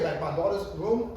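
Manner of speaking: usual speaking voice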